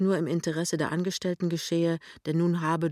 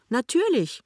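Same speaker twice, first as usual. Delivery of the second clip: bestimmt; 8 semitones higher, 4 dB louder than usual. A narrator's reading. Lämmchen bestimmt.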